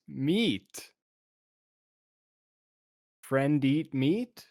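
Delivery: slow, simple words for the ogre; dumbed-down speech. Acted. Player slowly spelling it out to the ogre, simple talk. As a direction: speak it slowly like to someone who barely knows the language. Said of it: "I don't know how to dumb it down".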